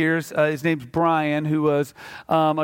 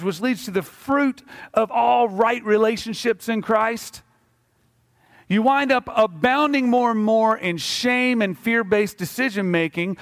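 raised, slow, emphatic preaching; impassioned preaching; 4 semitones higher than usual. Acted. Pastor urging the congregation to believe rightly, fervent preaching. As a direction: drive the point home to the congregation.

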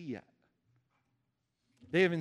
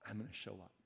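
lower, much softer quiet assurance; God's promise; 6 semitones lower, 13 dB quieter, much faster than usual. Retold or imitated. God promising the stressed to show up, softly. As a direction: promise it gently, under the breath. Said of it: "he says".

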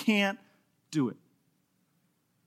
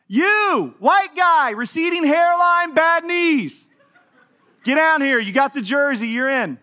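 high, loud coach voice picking a guy from the crowd; hammed-up coach waving him down; coach calling out; 7 semitones higher, 5 dB louder, slower than usual. Coach calls him from the bench.

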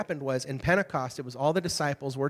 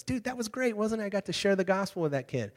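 excited; 6 semitones higher than usual. Retold or imitated. Excited friend retold.

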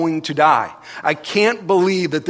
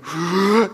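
acted gasp for air; 4 semitones higher than usual. Retelling nearly drowning.

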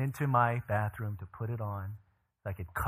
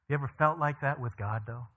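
normal talking voice

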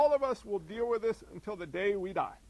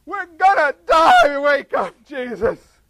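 loud, high, terrified yelling; panicked shout; 8 semitones higher, 18 dB louder, slower than usual. Acted. Disciples in the storm screaming at Jesus, terrified. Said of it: "the guys freaked out".

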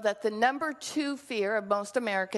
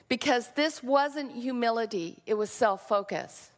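ordinary talk

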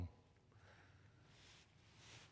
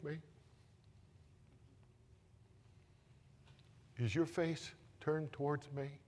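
tender hushed storytelling; 6 dB quieter than usual. Acted. Preacher telling the grieving boy's story, soft and tender. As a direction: draw them in gently.

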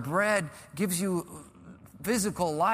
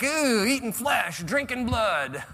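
slow, higher, ominous mock relish; spooky PG-13 tease; 8 semitones higher, 5 dB louder, slower than usual. Preacher imitates Jesus pushing buttons, spooky teasing. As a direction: drag it out like a spooky campfire tease. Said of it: "He goes all P G thirteen on us".

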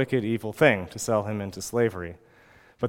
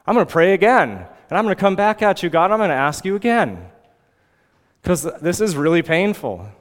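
higher-pitched, insistent plea; persistent prayer; 5 semitones higher, 4 dB louder, faster than usual. Acted. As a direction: keep coming back at God, refusing to give up. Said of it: "he keeps at it".

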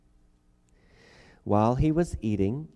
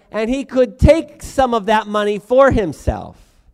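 high, loud preaching at full force; preacher building to a loud, impassioned peak; fiery preaching; 10 semitones higher, 8 dB louder than usual. Acted. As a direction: drive the point home to the congregation.

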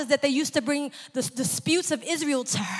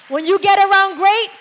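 higher and louder, building fervor; rising preaching; 5 semitones higher, 6 dB louder than usual. Real, not acted. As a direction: lift the congregation, voice climbing. Preacher building to full force.

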